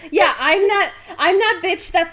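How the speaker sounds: high, loud laughing protest. Real, not acted. Laughing back at a friend's teasing about skating. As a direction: laugh it off while denying it. Teased about roller skate work.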